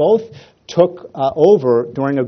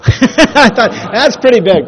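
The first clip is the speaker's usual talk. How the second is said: laughing; 10 semitones higher, 8 dB louder, slower than usual. Real, not acted. Laughing at Oprah line.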